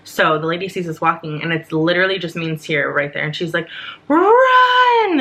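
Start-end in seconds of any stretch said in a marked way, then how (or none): 4.1-5.2 long, high-pitched shouted command; imitated urgent yell down the gate. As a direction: call it out loud, drawing out the word. Boarding lady yells at late passengers.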